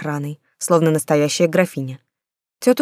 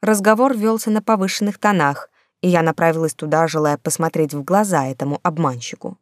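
plain reading voice

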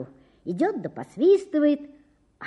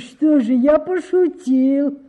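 excusing himself; 3 semitones higher, 7 dB louder, slower than usual. Acted. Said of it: "оправдывался Ёрш Ершович".

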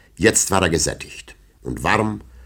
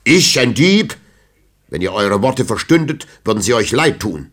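higher, louder offended cry; indignant outcry; 5 semitones higher, 6 dB louder than usual. A narrator's reading. Old knight outraged at the poet's words, loud. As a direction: fling it back in outrage. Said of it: "rief der Alte".

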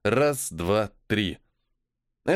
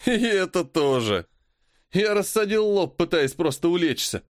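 shouting; 6 semitones higher than usual. Acted. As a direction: yell back with a laugh. Voice answering from the dark.

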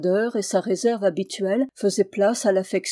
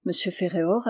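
ordinary delivery

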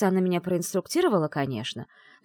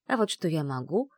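ordinary delivery

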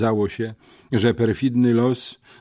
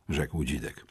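usual speaking voice

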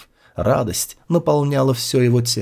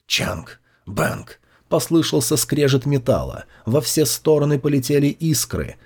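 plain reading voice

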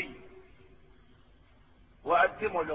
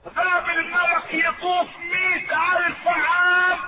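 full preaching; 8 semitones higher, 3 dB louder, slower than usual. Acted.

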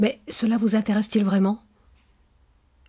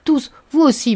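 louder, higher outburst; an abrupt, raised-voice challenge; sudden exclamation; 6 semitones higher, 6 dB louder than usual. A narrator's reading. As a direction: cry it out suddenly, pointing the accusation. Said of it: "s'écria-t-il subitement".

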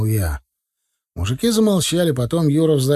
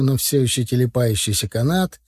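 ordinary delivery

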